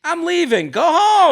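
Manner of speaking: mock huffy exit. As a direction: squeal it like storming out in jest. Mock telling date to leave.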